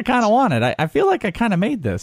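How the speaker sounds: high, insistent put-on voice; meme character voice. Host playfully claiming he invented the dish, mock insistent.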